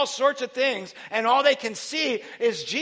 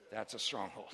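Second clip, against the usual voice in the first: ordinary delivery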